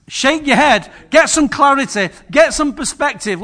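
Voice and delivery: building sermon emphasis; preaching force. Acted. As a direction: drive each phrase home.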